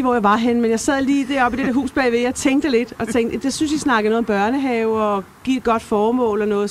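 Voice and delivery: usual speaking voice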